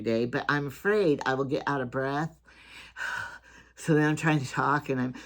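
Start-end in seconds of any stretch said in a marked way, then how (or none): 2.8-3.4 long tired sigh out; weary sigh. Real, not acted. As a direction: breathe out heavily, worn out. Worried about getting out of breath.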